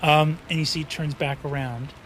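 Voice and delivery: ordinary talk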